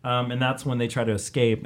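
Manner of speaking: ordinary talk, nothing marked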